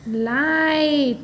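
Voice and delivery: Light with the vowel stretched out; slow teaching model of light; slow read-along. Shown as a demonstration. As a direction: draw out light for pupils to join in. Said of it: "फिर से एक बार साथ में पढ़िए".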